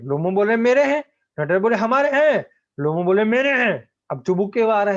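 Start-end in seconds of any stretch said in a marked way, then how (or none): 0.6-1.0 high, loud yell of mine; shouting. Acted. Lomov shouts at Natalia in the quarrel, high loud yell. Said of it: "क्यों आप shout कर रहे हैं?". 1.8-2.4 shouting back. Acted. Natalia yells back at Lomov over the meadows, high shout. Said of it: "क्यों आप shout कर रहे हैं?". 3.2-3.9 high yell, a touch less; shouting. Acted. Lomov keeps shouting at Natalia over the meadows, high yell. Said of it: "क्यों आप shout कर रहे हैं?".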